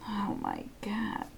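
slow, breathless whisper; stunned whisper. Real, not acted. Cohost marveling at the hour-long recording, whispered. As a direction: barely breathe it out, amazed.

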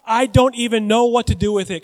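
preacher's hammed-up paraphrase of the rich man; rich man's complaint. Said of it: "In other words".

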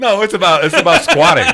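high, loud protest through laughter; giggling high-pitched defense; laughing protest. Real, not acted. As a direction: insist while laughing.

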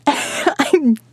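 giggling through the words; laughing. Acted. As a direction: laugh it out, slowly.

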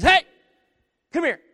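high, loud parrot call. Acted. Parrot hailing the returning customer, squawky call. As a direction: squawk it out to get attention.

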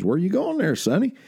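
a hint of a folksy old man hailing a passerby; mildly hammed-up old timer on his porch; old man calling out. Old man voiced in a story.

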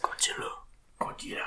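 unvoiced whisper of Godzilla; whispered name. Muttering the monster's name to the table in a whisper. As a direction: breathe the name like a secret.

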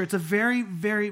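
ordinary talk, nothing marked

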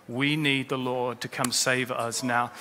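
ordinary talk

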